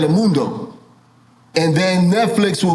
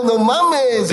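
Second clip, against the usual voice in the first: slow, higher Mexican accent imitation; mock Mexican accent; 6 semitones higher than usual. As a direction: draw out a teasing Mexican accent. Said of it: "You sound like".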